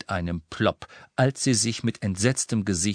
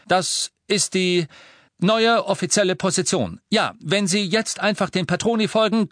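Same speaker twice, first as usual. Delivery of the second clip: raised official-sounding voice; addressing crowd; 9 semitones higher, 5 dB louder than usual. Acted. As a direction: speak with authority.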